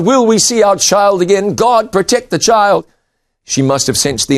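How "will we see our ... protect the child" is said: higher, pleading cry to God; pleading mother. Acted. Mother crying out to God by the river, lightly pleading. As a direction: beg God for the child, voice lifted.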